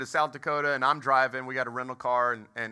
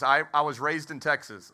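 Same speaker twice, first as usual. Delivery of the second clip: ordinary delivery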